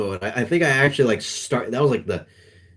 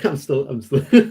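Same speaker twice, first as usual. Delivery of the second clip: laughing; 4 semitones higher, 4 dB louder than usual. Real, not acted. Cracking up mid-sentence.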